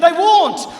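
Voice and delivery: loud, high defiant refusal; defiant shout. Retold or imitated. Husband shouting back at his wife, loud and defiant. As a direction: yell it right back at her.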